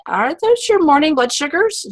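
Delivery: high, loud eager question; excited question. Retold or imitated. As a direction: ask it thrilled and bright. Excited question in retold call.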